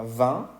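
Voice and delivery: "vin" with nasal vowel, silent N, rising; French pronunciation. Shown as a demonstration. Teacher giving French for vino, nasal "vin". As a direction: say "vin" through the nose. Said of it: "tenemos la palabra".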